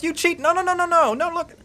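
very high, squabbling accusation; bickering sisters. Acted. Sisters arguing over slaps, bickering. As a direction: protest in a rapid childish squabble. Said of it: "continue to bicker amongst themselves".

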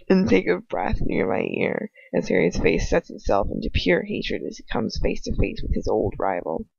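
normal talking voice

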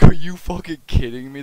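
loud mock disbelief; mock outraged yell. To the raiders, a loud mock outburst. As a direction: shout it like you can't believe it. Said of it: "I'm like".

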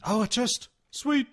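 high, loud delighted cry; thrilled. Real, not acted. Finding a chest, high loud delighted cry. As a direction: burst out with joy.

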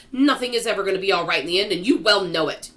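loud, fast, gloomy tirade; indignant cry. A narrator's reading. Earthworm contradicts the hopeful grasshopper, indignant cry. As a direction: hammer out the contradiction without slowing. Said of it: "cried the earthworm".

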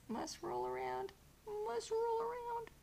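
very high, drawn-out cat voice; playful high-pitched pet voice; voicing the cat. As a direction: squeak it slowly as a rolling kitty. Voices the cat rolling around.

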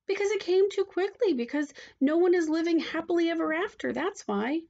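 put-on high, insistent child protest; indignant child. A narrator's reading. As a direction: argue indignantly about the ending. Child argues with storyteller.

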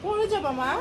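ordinary delivery

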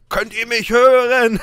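loud. Real, not acted. Mic check, asking if heard.

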